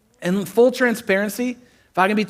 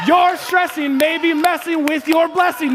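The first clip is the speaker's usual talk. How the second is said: proclaimed shout; 9 semitones higher than usual. Shown as a demonstration. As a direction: belt the line out slowly like a preacher's hook. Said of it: "I gotta say that one again".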